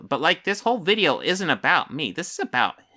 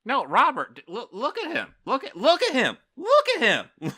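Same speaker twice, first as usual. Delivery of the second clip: higher and higher, squeaking with laughter; cracking up; 11 semitones higher than usual. Real, not acted. Streamer cracking up, telling Robert to look at the flexing guy, high squeaky laugh. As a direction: lose it while pointing him out.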